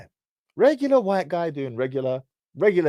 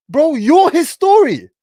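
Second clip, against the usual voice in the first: much higher, louder and slow; dramatic reveal; 12 semitones higher, 11 dB louder, slower than usual. Retold or imitated. The friend Rahib tells him the news, dramatic. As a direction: land it like a twist.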